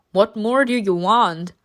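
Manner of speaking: ordinary delivery, nothing marked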